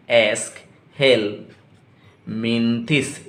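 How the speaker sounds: एस्कहेलमिंथिस stretched slowly, syllable by syllable. Shown as a demonstration. Teacher models the pronunciation for students, very slow. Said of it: "pronunciation कैसे होगा".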